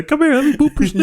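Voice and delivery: squeaky cutesy voice. Shown as a demonstration. Friend showing how the snoot line must be said, high and squeaky. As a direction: squeak it like baby talk to a pet. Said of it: "you gotta say".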